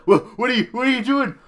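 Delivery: mock panicked cries rising high. Icarus's dad as Kratos locks him in, panicked. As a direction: cry out in growing alarm. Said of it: "He's like".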